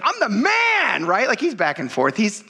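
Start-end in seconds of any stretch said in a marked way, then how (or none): 0.0-1.0 "I'm the man" drawn out slowly, higher and shouted; mock boast. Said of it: "And then he's like".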